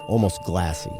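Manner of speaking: usual speaking voice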